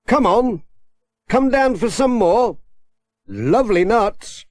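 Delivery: high coaxing call. A narrator's reading. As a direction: tempt the monkey down gently. Coaxing monkey down.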